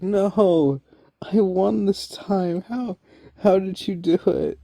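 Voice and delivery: high, loud, disbelieving villain wail; Riddler meltdown. Retold or imitated. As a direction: whine it like a sore loser in a meltdown. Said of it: "Riddler's having a, a meltdown".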